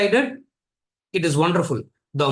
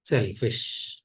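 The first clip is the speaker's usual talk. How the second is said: Yajnavalkya's verdict; 2 semitones lower, slower than usual. Retold or imitated. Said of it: "erases everything and calls".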